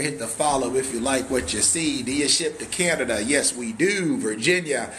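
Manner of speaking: ordinary talk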